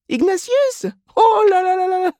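very high, loud wail of dismay; sad lament. A narrator's reading. Mrs Reilly despairs of her son Ignatius, lamenting. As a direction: lament in a high put-on woman's voice. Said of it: "dit tristement madame Reilly".

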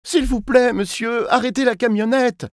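put-on very high, pleading little-boy voice; frightened child's plea. A narrator's reading. Kidnapped boy begging the man to stop, frightened plea. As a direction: beg like a scared child.

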